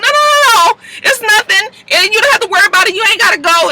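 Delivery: very high, hurried reassurance; hammed-up panicked pleading; pleading man. Desmond begging her to stay.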